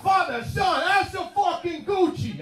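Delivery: high, hammed-up Italian accent. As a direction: proclaim it in a pumped-up Italian accent. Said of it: "a breakdown in Italian accents".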